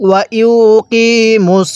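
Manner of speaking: ওয়া ইউ কি মুস with long কি, straight into মুস; joined-letter recitation. Shown as a demonstration. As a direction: hold ইউ and কি, close মুস on a heavy S. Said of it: "মিম লাগবে সোজা এই সদের সাথে".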